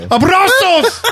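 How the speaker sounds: high, drawn-out comic yell; mock bellow in a very high voice; all-caps dad. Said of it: "it's always in all caps".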